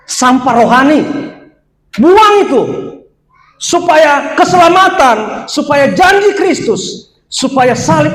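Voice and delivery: preaching shout. Real, not acted. Preacher exhorting congregation.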